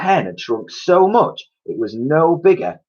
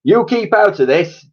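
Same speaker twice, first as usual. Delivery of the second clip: raised, higher sharp retort; cried out; 11 semitones higher, 7 dB louder than usual. A narrator's reading. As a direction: bark it at him to butt out. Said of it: "cried Mister Cranky".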